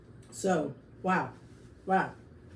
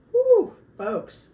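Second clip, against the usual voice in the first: 1 hot-pepper whew; 8 dB louder than usual. Real, not acted. After tasting hot salsa.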